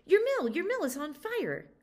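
high, loud panicked call; urgent alarm shout. Acted. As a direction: burst in crying out for the master. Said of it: "he shouted".

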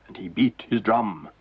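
ordinary delivery

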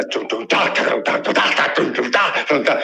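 put-on beatbox the kid's drum kit; imitated drumming. Narrator mimics the kid drumming at ten, vocal drum beats. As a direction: mouth drum beats, low and clipped. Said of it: "o menino ficava assim".

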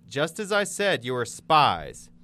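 loud, high start with a slow, hard-stressed finish; forceful declaration. A narrator's reading. Joseph accusing his brothers of spying, forcefully declaring. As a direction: declare the charge with full authority. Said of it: "Then Joseph declared".

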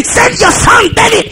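high, slow proclaimed command; acted summons. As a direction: send out the order high and drawn-out. Acted royal summons in retelling.

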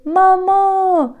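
very high, long call for mother; crying out. A narrator's reading. Arlo calling his mother from the river, crying out. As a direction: call for help, pitch far up. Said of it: "strigă Arlo, încercând să tragă aer în piept".

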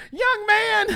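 very high mock old-lady call. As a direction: hail someone in a piercingly high imitation. Old lady spots him, calls out.

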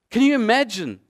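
usual speaking voice

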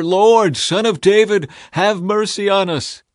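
loud, high, persistent cry; insistent shouting. A narrator's reading. Blind men defy the crowd's hushing, shouting loud and high. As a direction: keep calling out over the crowd, high and loud. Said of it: "they only shouted louder".

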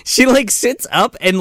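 normal talking voice

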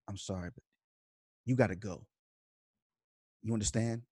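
low, hushed menace with long pauses; deathly quiet. Retold or imitated. Mike orders the interviewer out, imitated deathly quiet. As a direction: drop it right down and leave gaps between phrases. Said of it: "he gets deathly, like, quiet".

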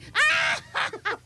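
carcajada fuerte. Retold or imitated. Imita su risa normal.

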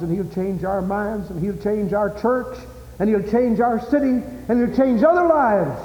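builds from high to shouted high climax; rising preaching. Acted.

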